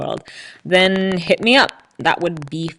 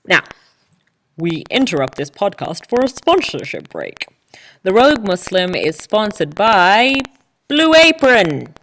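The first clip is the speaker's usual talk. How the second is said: mock announcer accent; 4 semitones higher, 5 dB louder than usual. Podcaster fakes a sponsorship break for listeners, hammed-up announcer voice. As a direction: ham up a fake sponsor read in an odd accent. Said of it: "don't know what that accent is".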